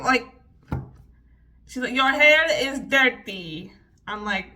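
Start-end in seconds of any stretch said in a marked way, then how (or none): 1.9-3.7 loud, drawn-out mock Russian accent. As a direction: scold like a mean Russian hairdresser with the accent. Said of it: "doing that accent throughout this little".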